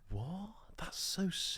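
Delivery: hushed awed whisper. Real, not acted. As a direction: whisper it in disbelief. Awed reaction to Santa follow.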